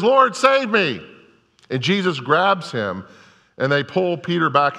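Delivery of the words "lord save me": fearful cry. Retold or imitated. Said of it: "And he says".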